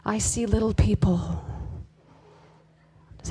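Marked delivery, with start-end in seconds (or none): 0.0-1.4 slow hushed spooky whisper; mock movie-quote whisper; Sixth Sense whisper. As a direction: whisper it like the scared boy in the film. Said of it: "that Haley Joel Osment character".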